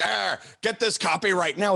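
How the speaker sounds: put-on impatient demand; imitated bossy order. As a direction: imitate a boss ordering staff around. Imitates bosses snapping at underlings.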